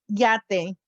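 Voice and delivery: yate said YAH-teh with a crisp t; Spanish yate. Shown as a demonstration. Teacher modelling the word yate, Spanish pronunciation. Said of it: "It sounds like Y".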